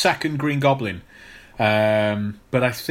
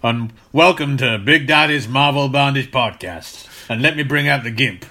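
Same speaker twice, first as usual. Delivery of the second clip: hammed-up southern accent, louder host voice; Big Daddy southern accent; 4 dB louder than usual. Joking cohost hosts a fake podcast in a mock southern accent. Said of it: "Don't know why you had to do a southern accent".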